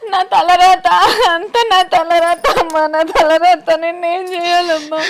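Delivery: loud, sobbing despair; helpless weeping daughter; crying. Acted. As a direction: wail it out between sobs, blaming fate. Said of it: "ఏడవకు".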